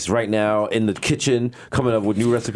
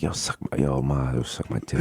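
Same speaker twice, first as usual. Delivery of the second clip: low, soft, affectionate murmur; 12 semitones lower, 6 dB quieter than usual. Shown as a demonstration. Showing how New Yorkers say it with affection, tenderly. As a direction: say it fondly, dropped low and quiet. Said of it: "you can say it with affection".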